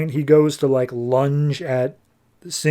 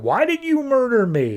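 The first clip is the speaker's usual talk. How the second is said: mock outraged confrontation; 11 semitones higher than usual. Storms in to confront his killer.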